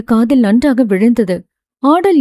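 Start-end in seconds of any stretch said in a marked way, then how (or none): 1.8-2.2 name called out higher, ஆடல் lifted; calling her name. A narrator's reading. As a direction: call ஆடல் to get her attention. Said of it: "என்று அழைக்கவும்".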